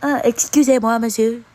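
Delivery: pompous fake French; mock French accent.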